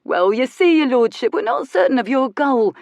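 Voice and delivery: a cheeky workman playing dumb; Cockney workman. Man in the cap answering the Marquis, cheeky Cockney voice. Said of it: "said the man in the cap".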